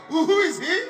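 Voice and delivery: hammed-up baffled official; mock puzzled question. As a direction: play the clueless man asking who he is. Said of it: "the man asked".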